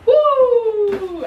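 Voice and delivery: high, loud joyful whoop; excited whoop. Real, not acted. Finding her t-shirts in the box, a high loud whoop. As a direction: cheer like you just won.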